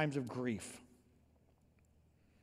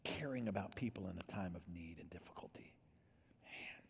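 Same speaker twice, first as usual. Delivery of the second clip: softer, hushed whisper; 6 semitones lower, 10 dB quieter than usual. Acted. Pastor moved by believers caring for others, hushed. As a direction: drop to a tender whisper. Moved by people who care.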